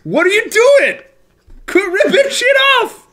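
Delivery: high, loud mock-angry rant; playful shouted scolding of a copycat; mock outrage. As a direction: yell it hammed-up, climbing to a high screech.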